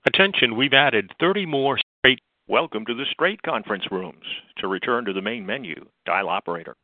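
phone menu voice. Acted. Automated conference line announcement.

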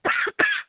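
sharp throat-clearing cough; quick dry cough. Real, not acted. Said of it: "Frog in my throat".

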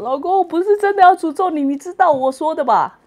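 high, coaxing wifely plea; sweet-talking husband. Pretend. Wife sweet-talking husband after quarrel, high coaxing. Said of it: "老公心情好的时候".

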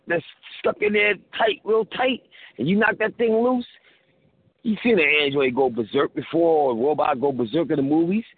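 ordinary talk

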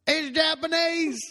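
high, loud slow twang; drawn-out Southern pop star; [name] voice. Pretend. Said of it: "[name]".

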